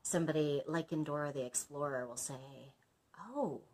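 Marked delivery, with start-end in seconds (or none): 3.2-3.6 pantomime host. Retold or imitated. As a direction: tease the kids in a sing-song. Pantomime performer voiced.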